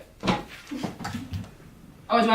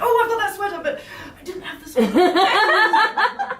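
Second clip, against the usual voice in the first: awkward nervous English guy, much higher, slow, laughing; nervous Simon Amstel; 10 semitones higher, 5 dB louder, slower than usual. Retold or imitated. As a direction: stammer it out flustered and awkward, drawn out at the end. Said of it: "very awkward and nervous like this".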